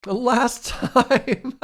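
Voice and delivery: words breaking into loud high laughter; stretched words dissolving into a big laugh; real laughter. Real, not acted. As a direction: crack up mid-sentence and keep laughing. Bursts out laughing while talking to chat.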